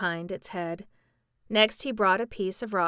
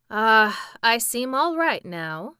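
sighed out with relief, a little louder; sighing; 3 semitones higher, 7 dB louder, slower than usual. A narrator's reading. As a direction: let out a sigh then speak. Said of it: "with a sigh".

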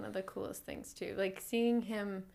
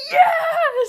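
loud high cheer; excited shout; 18 dB louder than usual. Retold or imitated. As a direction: cheer like a thrilled kid. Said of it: "She's like".

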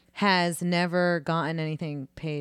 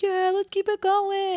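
mock cheery reply; 13 semitones higher, 3 dB louder, slower than usual. Retold cheerful reply at drive-through.

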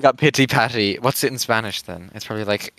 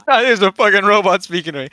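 1 mock outrage; 8 semitones higher, 6 dB louder, faster than usual. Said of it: "it's just a robot saying".

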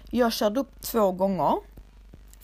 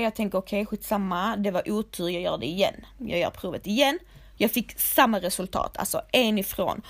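usual speaking voice

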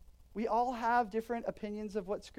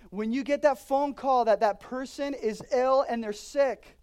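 louder, slow, building sermon; impassioned preaching; 4 semitones higher, 8 dB louder, slower than usual. Acted. Pastor stirring the congregation, a louder slow impassioned plea.

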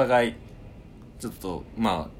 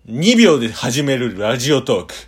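loud, slow, higher dramatic ad voice; epic commercial announcer; 7 semitones higher, 15 dB louder, slower than usual. Pretend. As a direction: boom it out like an over-the-top trailer. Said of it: "じゃあ行きます".

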